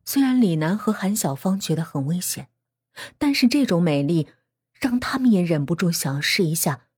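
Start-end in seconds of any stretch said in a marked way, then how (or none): none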